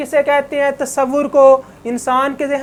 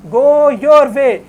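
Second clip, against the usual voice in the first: slow quoting; 5 dB louder, much slower than usual. Shown as a demonstration. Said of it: "पहले भी first revelation के अंदर word आ चुका है".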